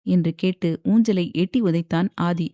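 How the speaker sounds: ordinary delivery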